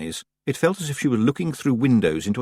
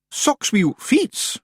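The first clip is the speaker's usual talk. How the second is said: very high, slow, Scottish pixie voice; high little Scottish chieftain; Rob Anybody; slower than usual. Acted. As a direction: squeak it like a tiny Scots rogue.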